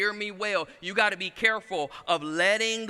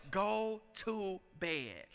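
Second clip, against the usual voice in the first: slow, much softer go to bed; weary dad, barely bothering; drawn-out soft order; tired command; 9 dB quieter, much slower than usual. Retold or imitated. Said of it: "I say".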